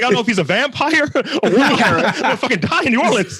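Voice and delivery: high, laughing mock panic; retelling a streetcar scare, laughing and high. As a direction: ham up the fear while laughing. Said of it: "I was like".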